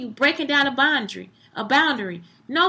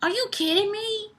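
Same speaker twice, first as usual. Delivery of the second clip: retold disbelief; 8 semitones higher, 4 dB quieter than usual. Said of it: "I was like".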